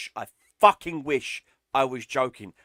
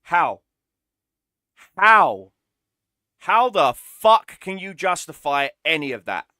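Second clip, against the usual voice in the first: louder, frustrated demand; exasperated; 5 dB louder than usual. Real, not acted. Ranting at the matchmaker, louder exasperated question. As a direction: throw the question out in disbelief.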